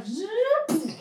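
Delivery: high mouth-made zoop of a charging gun; playful weapon sound effect, high. Shown as a demonstration. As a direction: make the gun noise, pitched right up. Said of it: "I love hearing my gun go".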